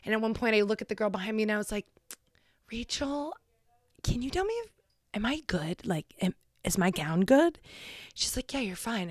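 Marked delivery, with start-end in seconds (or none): 2.7-7.6 put-on hushed whisper to the girl behind. Whispering during graduation ceremony. 8.5-9.1 quick hushed whisper; friend's whispered reply. Retold or imitated.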